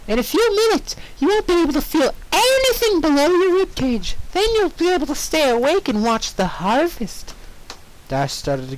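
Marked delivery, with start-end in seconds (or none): 0.1-7.3 cheerful as if nothing's wrong; high cheery Pinkie. Acted. Pinkie explaining the harvest.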